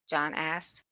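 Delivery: plain reading voice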